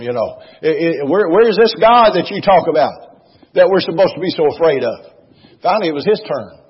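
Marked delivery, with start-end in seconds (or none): 0.6-5.0 louder, scoffing challenge; mocking challenge. Elijah mocking the prophets of Baal, scornful challenge. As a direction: dare them to show their god. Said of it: "he just really riddled them".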